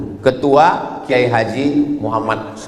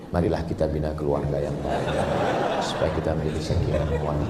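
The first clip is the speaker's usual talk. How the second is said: much softer, gentle and sweet; 9 semitones lower, 10 dB quieter, faster than usual. Shown as a demonstration. Preacher trying a soft Javanese-style voice. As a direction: try to sound tender and mild. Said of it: "udah setel ngomong itu lembut".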